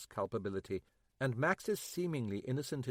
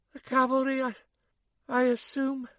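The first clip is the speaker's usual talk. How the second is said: weak wry woman; 13 semitones higher, 6 dB louder than usual. Acted. Wounded May, weak and wry.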